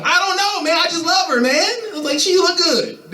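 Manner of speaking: squeaky high and smitten; high lovesick whine. Retold or imitated. To his friends, a squeaky high smitten whine.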